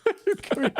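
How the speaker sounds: short high laugh; burst of laughter. Real, not acted. Co-host laughing at toddler joke.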